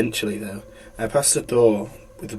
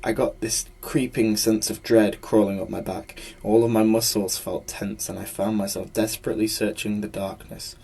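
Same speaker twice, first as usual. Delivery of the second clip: ordinary delivery, nothing marked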